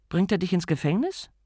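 asking her husband lightly; Lady Sybil to husband. Acted.

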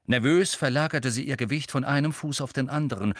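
ordinary delivery, nothing marked

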